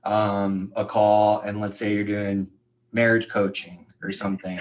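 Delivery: usual speaking voice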